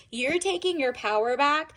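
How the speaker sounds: usual speaking voice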